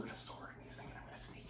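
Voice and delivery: hushed whisper, sneaking in. Acted. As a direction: whisper it like you're creeping through a gate. Conan sneaking into the city.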